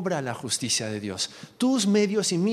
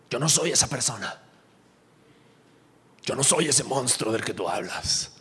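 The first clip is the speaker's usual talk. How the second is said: low, offended retort; acted indignation; 5 semitones lower than usual. Believer reacting to an unwelcome word, acted anger. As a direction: act out taking offence, in a low voice. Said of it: "lo primero que haces es enojarte, saltar".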